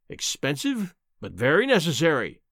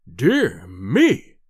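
deep, loud sneer; sneering professor; 6 semitones lower, 5 dB louder than usual. Acted. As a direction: scoff it with contempt.

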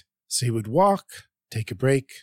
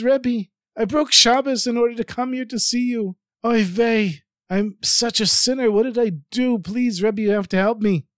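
higher, pleading, yiddish-tinged voice; pleading yiddish accent; 8 semitones higher than usual. A narrator's reading. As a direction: beg the rebbe in a yiddish lilt. Melamed pleading with the rebbe.

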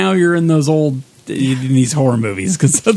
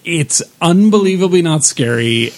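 ordinary delivery

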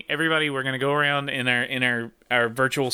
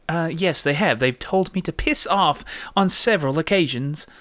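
put-on proper British reply; standard British accent; 3 semitones higher than usual. Shown as a demonstration. Host replying to the insult, standard British accent. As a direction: answer the insult in posh-game British. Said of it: "We're gonna go standard British".

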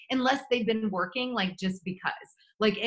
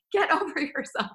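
laughing, higher, half-joking; 7 semitones higher than usual. Real, not acted. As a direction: say it through a laugh. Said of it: "But, like, seriously".

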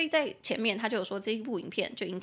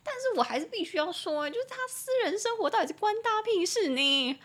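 indignant outburst; 10 semitones higher than usual. Real, not acted. Host outraged at the public scrutiny.

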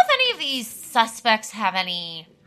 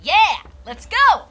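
loud drawn-out cheer; hyped cheer; 10 semitones higher, 7 dB louder, slower than usual. Acted. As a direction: pump them up, slow and loud. Hype cheer.

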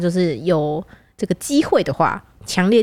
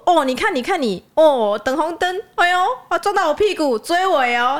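higher mock scolding uncle; 8 semitones higher than usual. The uncle scolds the driver for the rear-end bump, higher mock scolding. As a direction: wag a finger at the rear-ender. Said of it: "讲台语".

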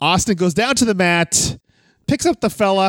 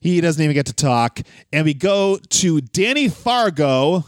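normal talking voice

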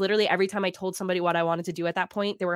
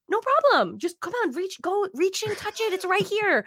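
much higher, sing-song luring voice; teasing tempter; 11 semitones higher than usual. Pretend. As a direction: dangle the dream just out of reach. Dream voiced as tempting offer.

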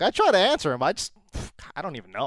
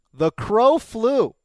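slow dramatic, raised; 4 semitones higher, much slower than usual. Acted. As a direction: proclaim it slowly and grandly. Repeated louder, higher.

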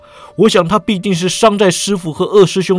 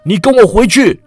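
higher, louder furious order; sudden fury; 5 semitones higher, 4 dB louder than usual. Acted. Eunuch lord ordering his goddaughter home, furious. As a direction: bark the order in a burst of rage. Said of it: "魏忠贤勃然大怒啊".

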